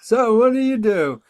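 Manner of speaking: high, pointed asking; a high mocking "So what do you do?"; mock dreaded question. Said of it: "that question I have never enjoyed".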